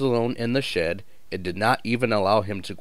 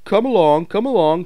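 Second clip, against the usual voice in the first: high, loud chugging urging; puffing engine call; 10 semitones higher, 7 dB louder than usual. A narrator's reading. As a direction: puff it out like a steam engine. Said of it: "he puffed".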